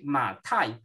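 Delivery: "Ma Tai" stretched slowly; slow teaching demo of "Ma Tai"; Mandarin demo. Shown as a demonstration. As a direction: model "Ma Tai" for students. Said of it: "traduzido em apenas dois ideogramas".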